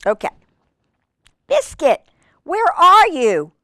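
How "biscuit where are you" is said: higher, drawn-out storybook calling; reading to children. Acted. Reader calling the lost puppy, high and drawn out. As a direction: call out playfully for the puppy.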